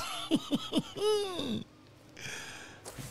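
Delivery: high squealing laugh. Real, not acted. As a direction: laugh with glee. Laughing after grabbing loot.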